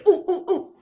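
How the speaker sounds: high, eager ooh sounds; eager ooh to cut in. Shown as a demonstration. Coach showing how to break into a meeting eagerly. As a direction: sound like a keen student itching to jump in.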